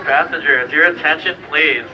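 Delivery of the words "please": normal talking voice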